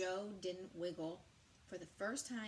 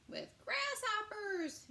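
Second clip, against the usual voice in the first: much higher, louder and slow; gleeful shout; 6 dB louder, slower than usual. Acted. Joe gleefully listing bug pie fillings to the animals. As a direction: squeal it with delight. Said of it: "Joe shouted gleefully".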